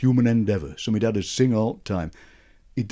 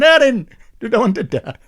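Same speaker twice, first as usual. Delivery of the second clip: much higher, louder Sheffield uncle; Uncle Jack voice; 12 semitones higher, 5 dB louder than usual. Retold or imitated. Uncle offering the trick jam tart, high Sheffield voice. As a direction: ham up the joking uncle. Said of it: "Uncle Jack going".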